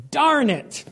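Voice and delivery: high, loud outburst of frustration; angry yell. Retold or imitated. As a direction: blurt it out sharply, losing your temper. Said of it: "I just yelled".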